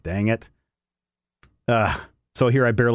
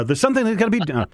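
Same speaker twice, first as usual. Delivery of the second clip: fast, pitched-up excitable scientist; lightly voiced frantic inventor; Doc Brown style Rick; 10 semitones higher, faster than usual. Host riffing in a gravelly Rick voice, quick. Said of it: "sounds more like Doc Brown".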